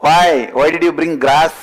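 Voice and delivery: high and loud, a booming lion's demand; lion's challenge. Acted. Lion confronting the cub about the grass, loud and high. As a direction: boom it out like a suspicious lion.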